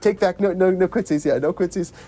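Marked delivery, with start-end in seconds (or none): none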